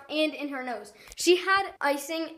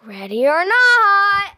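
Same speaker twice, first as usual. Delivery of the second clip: loud, slow, high call; 6 semitones higher, 13 dB louder, much slower than usual. Real, not acted. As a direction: call it out to the whole house. Seeker calling out in hide and seek.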